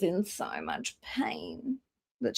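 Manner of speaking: slow, breathy whisper of sympathy; hushed whisper. Real, not acted. Telling chat a viewer had to log off in pain, slow whisper. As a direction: drop to a whisper, slowly.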